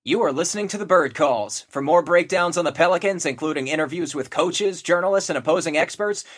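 loud, punchy promo read; radio announcer. Acted. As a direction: sell the show like a radio spot.

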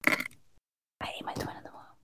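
eerie deadpan whisper; Pitch Perfect girl whisper. Retold or imitated. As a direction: whisper it creepily like the quiet girl. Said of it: "That girl from Pitch Perfect".

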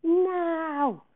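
loud, high, long yell; shouted. A narrator's reading. Director hurrying the slow set director, shouting. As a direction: bellow for instant action. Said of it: "shouted Davenport in his face".

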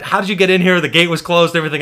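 guard scolding the bus driver for letting them in; mock angry guard. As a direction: bark at the driver as if caught. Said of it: "is getting yelled at".

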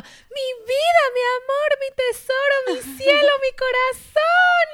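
high, slow, loud sing-song calling of pet names; doting mother shouting. Retold or imitated. Mom yelling endearments at her daughter outside school, high and loud. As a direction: call out lovingly across the schoolyard. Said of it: "gritándome".

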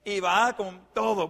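ordinary talk, nothing marked

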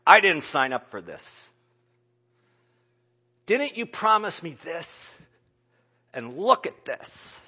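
loud, accusing complaint to God; raging at God, loud and bitter; angry lament. Retold or imitated. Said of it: "Statements like".

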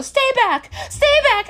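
high, loud frantic warning; mock panicked mom. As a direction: squeal it like a scared mother. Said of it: "she's telling the girl".

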